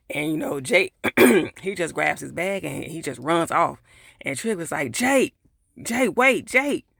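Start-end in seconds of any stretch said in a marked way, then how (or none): 4.9-6.9 high, loud call after someone running away. Retold or imitated. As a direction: shout after him so he stops. Trig calling after Jake running off.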